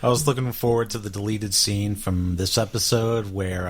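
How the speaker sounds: usual speaking voice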